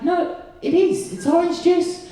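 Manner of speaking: squeaky, meek insistence; high-pitched protest. Acted. Boyfriend defending his orange juice to his girlfriend, squeaky protest. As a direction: plead in a very high little voice.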